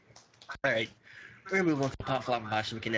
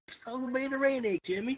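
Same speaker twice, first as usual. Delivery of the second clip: put-on country accent; 10 semitones higher than usual. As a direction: whine it in a country twang. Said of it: "I can do a country accent".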